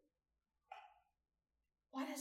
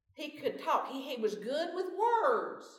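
loud, higher Southern accent; 6 semitones higher, 6 dB louder than usual. Shown as a demonstration. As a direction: play up a Southern drawl, stretching the last words. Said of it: "say it with a southern accent".

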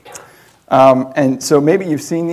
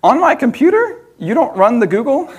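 much higher, incredulous mom voice; baffled mom; 10 semitones higher, 4 dB louder than usual. Retold or imitated. Voicing his mom confused about the Google, high and incredulous. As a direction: squeak it like a confused mother.

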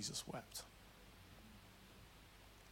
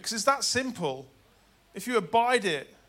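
preach; 9 semitones higher, 11 dB louder than usual. Acted.

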